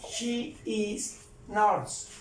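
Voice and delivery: teacher modelling the full form slowly; very slow model. Shown as a demonstration. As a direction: spell out She is nurse at a crawl. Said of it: "aquí lo voy a suprimir".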